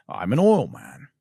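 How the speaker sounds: slow, drawn oil man line; a hint of Daniel Plainview; movie quote voice. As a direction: deliver it like the film's oilman.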